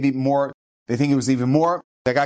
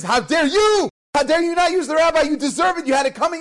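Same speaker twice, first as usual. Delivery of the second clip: angry shout; 12 semitones higher than usual. Retold or imitated. Townspeople yelling back at the family, angry shout. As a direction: yell it back at them in outrage.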